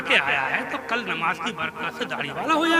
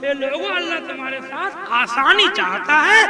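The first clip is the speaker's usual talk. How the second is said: builds from raised to loud, climbing high; rising preaching fervour; 9 semitones higher, 9 dB louder than usual. Real, not acted. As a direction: drive it home with growing fire to the crowd. Preacher explains verse with rising force. (बढ़ते जोश के साथ ऊँची आवाज़ में तक़रीर)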